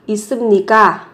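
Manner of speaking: now say 있습니까 at native speed; 있습니까 said quickly and smoothly; natural speed. Shown as a demonstration. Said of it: "Chú ý về cách phát âm".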